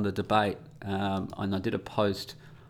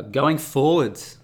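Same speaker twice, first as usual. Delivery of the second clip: going forwards drawn out slowly and louder; slow jargon phrase; 4 semitones higher, 7 dB louder, much slower than usual. Shown as a demonstration. Vlogger showing management speak, slow loud. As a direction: announce going forwards like a buzzword. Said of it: "I've got some management speak here".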